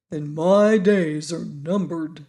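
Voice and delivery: slow, heavy, sighing pumpkin head; sighing gloomily. A narrator's reading. Pumpkin head fears for his life to Tip, slow sighing gloom. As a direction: let each word sink like a sigh. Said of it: "observed Jack with a deep sigh".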